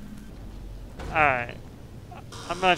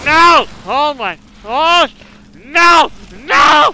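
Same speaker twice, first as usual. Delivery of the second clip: high, loud yells climbing in pitch; despairing shouts; 9 semitones higher, 11 dB louder, slower than usual. Real, not acted. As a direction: cry out louder and higher with each no. Dying in the game.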